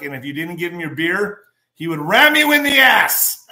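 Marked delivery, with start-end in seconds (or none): 1.7-3.5 excited. Real, not acted. Excited telling of goat story.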